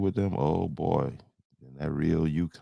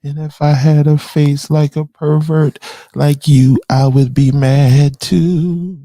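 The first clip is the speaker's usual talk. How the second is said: much higher and louder jeering; loud mocking voice; 11 semitones higher, 9 dB louder than usual. Real, not acted. Streamer jeering at a viewer, loud high voice. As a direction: jeer at him, high and loud.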